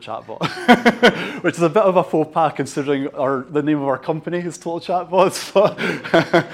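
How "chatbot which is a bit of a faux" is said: slow and loud through a laugh; laughing at own joke. Real, not acted. Joke about own company name.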